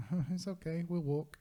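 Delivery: high-pitched, playfully anxious decline; retold worried refusal. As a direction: act out politely wriggling out of a worrying offer. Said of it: "a little bit worried about this".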